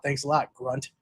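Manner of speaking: normal talking voice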